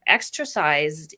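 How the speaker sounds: ordinary talk, nothing marked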